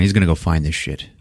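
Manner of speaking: ordinary talk, nothing marked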